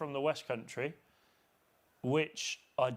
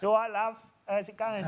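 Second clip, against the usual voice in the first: higher, louder cheeky Essex greeting; Essex impression; 9 semitones higher, 6 dB louder than usual. Host greeting a friend in a mock Essex accent. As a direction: chirp it like an Essex local, all right love. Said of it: "familiar with an Essex accent".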